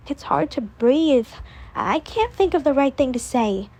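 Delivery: usual speaking voice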